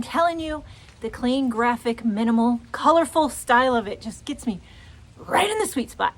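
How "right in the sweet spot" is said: slightly breathless talk; out of breath. Real, not acted. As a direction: talk a little winded. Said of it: "I don't know why I'm out of breath".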